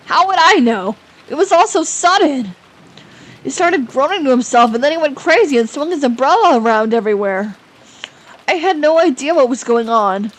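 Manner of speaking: high, rattled, defensive account; agitated protest. Acted. Man explaining a sudden death, agitated protest. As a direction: pour it out in alarm.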